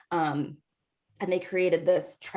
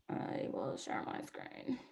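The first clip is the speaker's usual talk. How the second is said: soft breathy whisper to herself; whispered aside; 8 semitones lower, 15 dB quieter, slower than usual. Real, not acted. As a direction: murmur it under your breath, slow. Muttering to herself while screen sharing.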